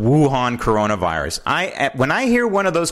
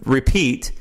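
ordinary delivery

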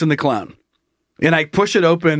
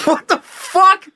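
slow, very high mock disbelief; incredulous; 12 semitones higher, slower than usual. Man reacting to the janitor's news, high drawn-out disbelief. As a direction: stretch it out high, totally baffled.

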